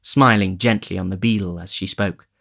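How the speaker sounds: plain reading voice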